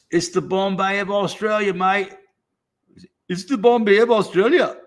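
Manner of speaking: high hammed-up Australian accent; mock Australian accent. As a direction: play it like a cheeky Aussie, mate. Mock Aussie line with mate.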